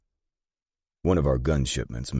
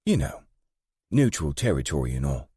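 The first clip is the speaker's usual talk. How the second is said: throwing words back; 2 semitones higher, 3 dB louder than usual. A narrator's reading. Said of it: "throwing my words back at me".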